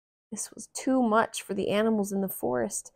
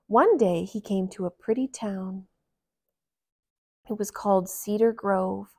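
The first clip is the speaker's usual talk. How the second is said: ordinary delivery, nothing marked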